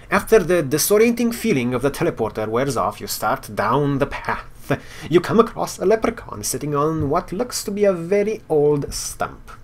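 reading game text aloud like a storyteller; story narration. Acted. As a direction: narrate the quest scene with flair.

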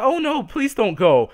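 high, slow imitated plea; mock pleading. Woman begging him to stay, hammed-up pleading. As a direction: beg in a put-on woman's voice.

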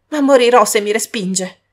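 loud pleading cry; desperate cry. Acted. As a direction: cry out in despair. Beggar's last plea.

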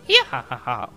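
villain giggle; evil cackle. Acted. Voicing villain laugh.

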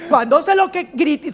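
normal talking voice

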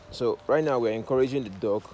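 ordinary talk, nothing marked

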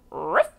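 short rolled growl into a bark; dog's ruff. A narrator's reading. Storyteller recapping the pig's sound, short growly bark. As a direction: give a quick growly ruff, flicking up. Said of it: "The pig said".

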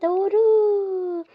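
high, loud sudden shout; excited cry. Acted. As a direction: cry out in realization. Sudden realization. (heyecanlı çığlık)

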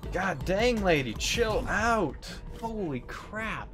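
high, drawn-out startled exclaim; exasperated surprise. Real, not acted. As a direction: stretch it out in real disbelief. Boss attack catches streamer off guard.

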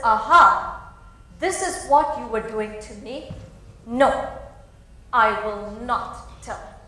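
loud, indignant outburst; indignant refusal. Acted. The friend scolds the heroine for using her, loudly indignant. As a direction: catch her out, then refuse flatly.

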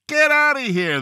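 high-pitched bartender's shout; acted shout, pitched well up; mock shout. A narrator's reading. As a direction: shout it high, ordering a customer out. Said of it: "the bartender shouted".

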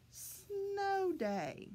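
snow day said slowly, snow stretched high; teaching a new word. Shown as a demonstration. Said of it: "the words that we are learning is".

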